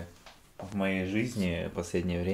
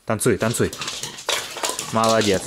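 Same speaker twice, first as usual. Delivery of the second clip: coaxing dog commands; 6 semitones higher, 6 dB louder than usual. Real, not acted. Уговаривает собаку танцевать.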